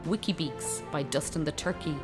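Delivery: ordinary talk, nothing marked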